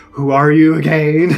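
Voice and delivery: impression of dad. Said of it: "he does an impression of him".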